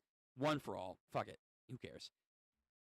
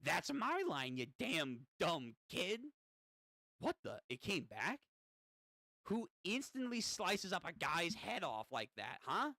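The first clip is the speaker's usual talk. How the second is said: gremlin demon, indignant; 4 semitones higher than usual. Acted. Demon scolding the kid who cut him, in gremlin voice. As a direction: scold like an affronted little imp.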